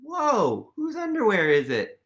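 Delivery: plain reading voice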